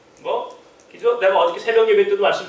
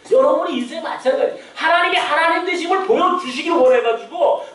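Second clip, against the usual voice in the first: raised, pressing sermon voice; fervent preaching; 7 semitones higher than usual. Acted. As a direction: build the climax from the pulpit.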